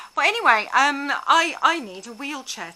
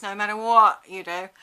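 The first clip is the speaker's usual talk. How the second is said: ordinary delivery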